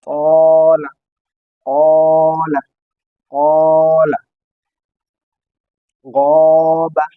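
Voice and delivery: তলা, কলা, গবা, গনা said loud and drawn out with a full, heavy mouth; heavy thick pronunciation. Shown as a demonstration. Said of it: "একটু মোটাভাবে উচ্চারণ করতে হয়".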